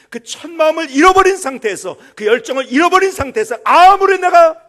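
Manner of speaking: loud, high impassioned preaching; preaching at full force. Acted. Pastor urging the congregation to keep their first love, shouting fervently. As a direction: drive it home to the back pews.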